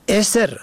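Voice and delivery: اسر said esr, kasra on first, no vowel on second; اسر pronounced esr; e then silent s. Shown as a demonstration. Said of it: "به کسر اول و سکون دوم".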